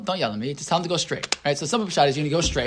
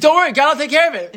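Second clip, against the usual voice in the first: higher, louder, quick breezy reassurance; breezy Moshe; 10 semitones higher, 4 dB louder, faster than usual. Retold or imitated. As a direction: toss it off like nothing can go wrong. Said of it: "he's like".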